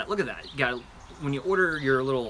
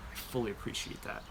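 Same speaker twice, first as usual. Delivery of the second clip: whispered aside; 2 semitones lower, 10 dB quieter than usual. Real, not acted. Repeated as soft aside.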